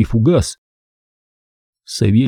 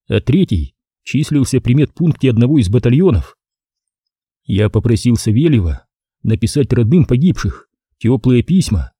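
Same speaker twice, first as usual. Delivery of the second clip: plain reading voice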